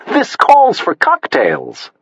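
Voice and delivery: high, slow, gushing socialite; posh society lady. A narrator's reading. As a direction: drawl it out like a rich hostess.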